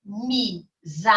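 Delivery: "Amizade" sounded out gently with a gap after each syllable. Shown as a demonstration. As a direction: break "Amizade" into a, mi, za, de. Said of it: "têm quatro ou mais sílabas".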